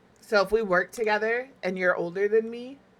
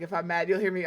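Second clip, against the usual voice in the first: ordinary talk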